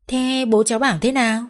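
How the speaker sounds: ordinary delivery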